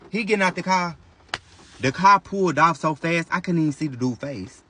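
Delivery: usual speaking voice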